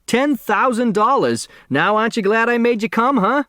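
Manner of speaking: put-on high, eager old man calling out; bursting with excitement to his companion; excited. A narrator's reading. Otis Amber to Crow, high and excited. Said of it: "Otis Amber said excitedly".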